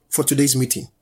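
ordinary talk

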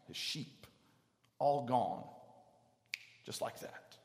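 normal talking voice

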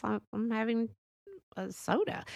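soft, faltering cover story; hesitant quiet reply. Retold or imitated. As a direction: mumble it as if dodging the question. Sissy evasive, caught out.

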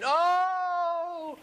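long, high "oh" held on one note; imitated scream. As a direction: mimic a woman's scream with a drawn-out high cry.

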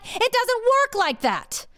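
high, drawn-out exasperation; exasperated retort. Retold or imitated. Wife refusing her husband's return, exasperated high retort. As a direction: stretch it out high, fed up.

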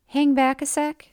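lightly voiced teacher asking someone to stay; teacher's casual request. A narrator's reading. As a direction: call a student back with a lifted question. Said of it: "Missus Z asked of me".

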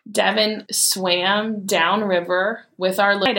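ordinary talk

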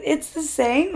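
high-pitched mock disbelief; incredulous question. Language learner checking an English word with her teacher, incredulous.

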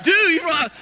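high, loud, wowed exclamation; amazed praise. Retold or imitated. Young clerk marvels at Howie's nerve, high and loud. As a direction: gush at your hero. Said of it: "I was like".

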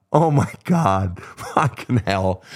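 slow, drawn-out re-enacted dismay; stretched-out, mortified disbelief; appalled regret. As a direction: groan it slowly, reliving your own blunder. Reliving his horror at own blunder.

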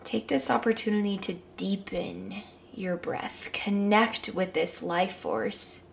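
calm guided meditation. Acted.

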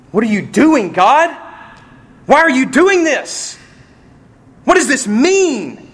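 high, loud desperate plea; anguished cry to God. Acted. Suffering believer crying out high and loud to God.

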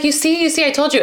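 high-pitched, excited friend voice; retold I-told-you-so. Friend to the storyteller after the wallet turns up, high excited I-told-you-so.

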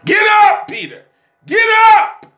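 high, loud preacher's call; fervent preaching shout; shouted command. Acted. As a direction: cry it out to lift Peter. Calls Peter to rise.

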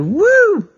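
high, loud whooping cheer; cowboy whooping as the horse jumps; cowboy woo. Acted. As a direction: let out a big yeehaw whoop. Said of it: "And he would go".

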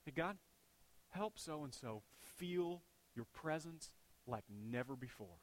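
soft, slow sincere prayer; a quiet demo prayer; model prayer. Shown as a demonstration. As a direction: pray it gently, slowly. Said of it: "Say this".

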